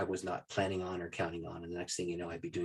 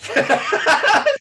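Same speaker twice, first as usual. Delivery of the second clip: high loud laugh; burst of laughter; 15 dB louder than usual. Real, not acted. Laughing as he tells the audience. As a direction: laugh out at the memory. Laughing after dad's demand.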